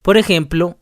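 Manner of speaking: normal talking voice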